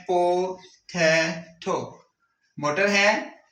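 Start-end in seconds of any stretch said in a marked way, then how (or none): 0.0-2.1 "पोटैटो" very slow, in three separate beats; broken into syllables. Shown as a demonstration. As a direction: pause after each syllable of "पोटैटो": po, tai, to. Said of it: "पोटैटो को हम जो अगर syllables में change करते हैं". ("पोटैटो" हर syllable अलग करके)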